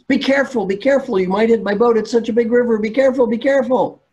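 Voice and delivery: high, loud warning shouts; acted agitated hollering; angry farmer. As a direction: yell across the water in alarm. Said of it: "Farmer is angry".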